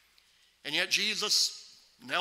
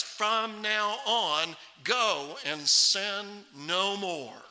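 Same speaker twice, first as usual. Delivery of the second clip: slow, weighty stress on "From now on, go and sin no more"; emphatic; much slower than usual. Retold or imitated. Preacher quoting Jesus on sin in her life, slow and emphatic. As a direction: draw out "sin no more" slowly and deliberately. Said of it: "what Jesus emphasized".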